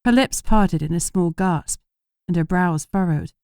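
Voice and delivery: usual speaking voice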